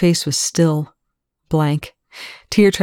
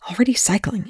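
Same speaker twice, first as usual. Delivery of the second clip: higher-pitched, irritated retort; snapping back; 6 semitones higher than usual. A narrator's reading. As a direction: answer testily, voice pitched up. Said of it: "Eli snapped back".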